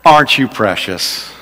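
sugary sarcasm, dropped low; sarcastic sweetness. Shown as a demonstration. Preacher demonstrates a sarcastic Southern compliment. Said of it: "almost always said sarcastically".

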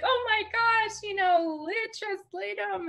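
crowd squealing high and excited; excited crowd cheer. Retold or imitated. Crowd cheering the dancer, high excited squeal. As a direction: gush like a thrilled fan in the crowd. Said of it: "the whole crowd was like".

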